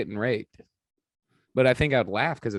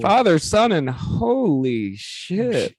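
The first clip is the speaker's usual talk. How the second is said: hammed-up holy rapture, slowing down; mock ecstatic prayer; 2 semitones higher, slower than usual. Mock ecstasy during cross joke.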